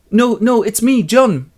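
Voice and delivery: high, loud pleading; urgent protest. Acted. John corrects his dying mother Linda, urgent high plea.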